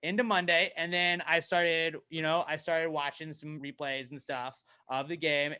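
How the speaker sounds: ordinary talk, nothing marked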